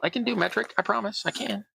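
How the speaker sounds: 1 playful protest. Pretend. Mock protest over unit mixup.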